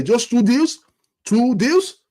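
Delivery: high, disbelieving question; can you believe it, just two deals; incredulous. Real, not acted. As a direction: pitch jumps up in outrage. Fan venting at transfer window.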